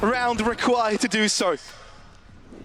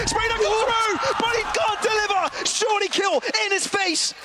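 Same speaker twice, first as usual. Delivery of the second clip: esports caster; 7 semitones higher than usual. Real, not acted. Caster hypes fight.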